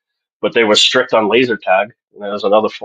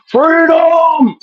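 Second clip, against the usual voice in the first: high shouted battle cry; William Wallace battle cry; 4 dB louder than usual. Pretend. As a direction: yell it like a rebel charging. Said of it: "I was a William Wallace".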